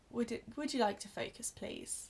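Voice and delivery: usual speaking voice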